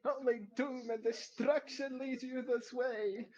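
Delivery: high, loud, slow proclamation of doom; childlike dark lord, drawn out and loud; high-pitched childlike villain. Acted. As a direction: intone it grandly in a high child's voice. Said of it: "it is still very high pitched".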